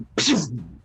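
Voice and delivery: whooshing spell-collision noise; imitated blast impact. Game master voicing a blast on a shield, whooshed. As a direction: make a burst of hissing air for the impact.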